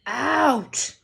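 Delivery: loud roared command. Acted. Uncle roaring at both boys, loud. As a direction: roar it at them. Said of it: "roared Uncle Vernon".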